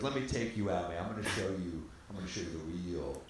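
low, hushed boast; imitating a man sharing his secret turf; Stu's cocky confiding. Acting Stu, cocky retold boast.

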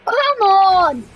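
"Come on!" yelled high and loud, falling; frustrated shout. Real, not acted. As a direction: bark it out at the screen. Urging the jump, shouting.

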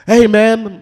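high, loud imitation of a stranger's hello; loud mock greeting. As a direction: call it out like an overfriendly stranger. Mimics strangers greeting him.

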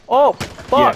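sudden high yelp of alarm; startled cry. Real, not acted. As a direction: caught off guard, cry out. Suddenly shot at in game.

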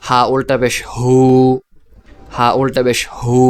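closing হু held as a long "hooo"; long madd vowel. Shown as a demonstration. Teacher models ulta pesh, final হু drawn out. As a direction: spell it, then stretch the final হু. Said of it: "এইভাবে".

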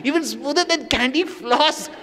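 mock swooning devotee; dreamy girlish gush. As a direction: gush dreamily like a smitten girl. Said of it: "she says".

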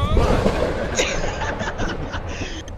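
burst of laughter. Real, not acted. Laughing mid countdown.

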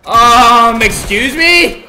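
very high, loud, slow excuse me; indignant. Real, not acted. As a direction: sound offended and outraged. Outraged at the game.

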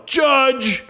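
snotty high yell for the judge; jerky yell. Retold or imitated. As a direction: bark it across the room like a jerk. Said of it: "in the jerkiest voice I could".

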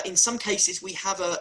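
ordinary delivery

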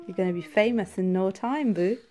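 normal talking voice

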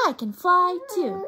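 high, loud bat squeal; excited baby bat. Acted. Stella Luna exulting as she flies, high squeal. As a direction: cheer it like a bat in flight.

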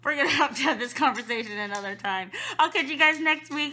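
loud laughing sign-off. Real, not acted.

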